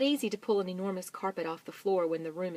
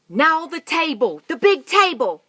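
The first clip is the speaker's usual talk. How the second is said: shouted order; 8 semitones higher, 14 dB louder than usual. Acted. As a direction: call out the next job. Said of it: "shouted Mugglewump".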